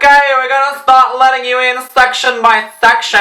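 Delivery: loud, high megaphone-style call; megaphone announcement. Retold or imitated. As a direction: blare it like a security megaphone. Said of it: "with their freaking megaphones".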